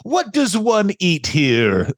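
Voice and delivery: slow, snooty mock diner voice; posh mock Rosie. Host mimics Rosie's snooty line, slow put-on voice. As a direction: drawl it like ordering Crown Royal at Denny's. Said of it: "the Rosie O'Donnell saying".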